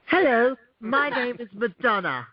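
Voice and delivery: high, slow fake British accent. Co-host mocking a singer's put-on accent, fake British. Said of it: "[name]'s fake British accent".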